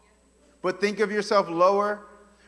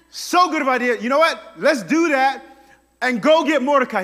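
louder, keen agreement; eager Haman; 4 semitones higher, 7 dB louder than usual. Retold or imitated. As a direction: rush to seize the idea. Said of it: "he's like".

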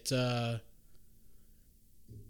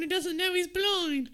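very high, loud put-on accent; hammed-up screechy rant; mock mouthy girl; 4 dB louder than usual. Girl tells knight about the blind man, comic screeching.

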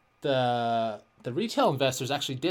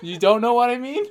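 incredulous; 12 semitones higher, 12 dB louder than usual. Real, not acted. Mock disbelief, laughing.

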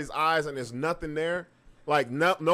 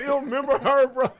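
high, loud imitation of calling out; mock holler; 8 semitones higher, 5 dB louder than usual. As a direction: echo the friend's yell from another room.

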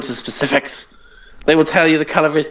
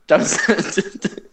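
laughing through it; 7 semitones higher than usual. Real, not acted. Repeats while laughing.

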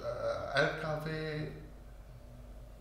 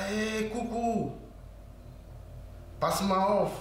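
imitating a crying wife; 7 semitones higher than usual. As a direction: voice her crying as she calls. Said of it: "Und weint. So laut". (nachgeahmte weinende Ehefrau am Telefon, höher und aufgelöst)

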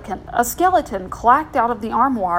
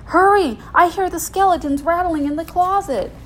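raised, hurried-sounding warning; urgent mock alarm; 7 semitones higher, 4 dB louder than usual. Kids scaring Hannah about skeletons, mock alarm. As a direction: hustle them along in pretend fright.

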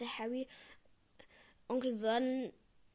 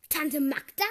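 blurted out in shock; 3 semitones higher, 8 dB louder, slower than usual. A narrator's reading. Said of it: "sprudelte aus ihm heraus".

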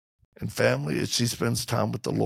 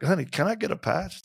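playful ask; 7 semitones higher than usual. Retold or imitated. Playful request to wife.

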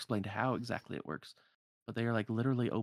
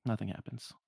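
normal talking voice

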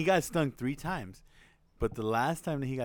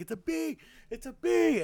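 mock panic. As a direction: imitate frightened little brothers. Acting panicked brothers.

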